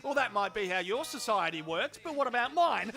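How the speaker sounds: ordinary talk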